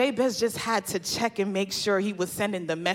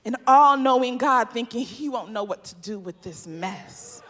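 ordinary talk, nothing marked

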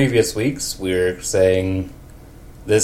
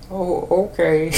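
put-on high, quavery old lady; 9 semitones higher than usual. Shown as a demonstration. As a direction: chirp it like an old woman. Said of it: "old lady for Marge".

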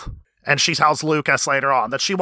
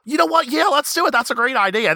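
eager agreement; 10 semitones higher than usual. Retold or imitated. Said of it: "she says".

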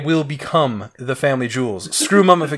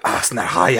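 throaty fake Egyptian from a mummy film; mock Mummy-movie accent; 4 semitones lower, slower than usual. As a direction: ham it up like the movie villain. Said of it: "See, that was way better".

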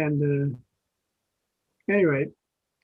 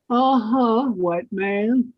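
sassy Whoopi; 5 semitones higher, much slower than usual. Retold or imitated. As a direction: tease him with sass. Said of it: "she says".

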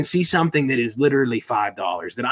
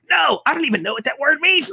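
much higher, shouted protest; squeaky, flustered nerd; the nerd shouts back at Boris, high; toy nerd voice; 4 dB louder, faster than usual. Retold or imitated. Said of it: "a huge toy nerd".

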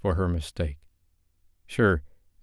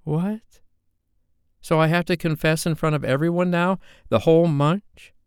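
put-on higher young woman, rattled and defensive; a young woman caught off guard, pitched up; Sophie, indignant; 10 semitones higher, 4 dB louder than usual. Sophie voiced, upset.

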